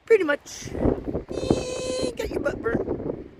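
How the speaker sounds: high silly voice. Acted. Joking voice to the tree.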